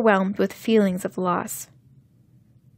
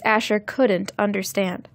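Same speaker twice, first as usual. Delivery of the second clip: plain reading voice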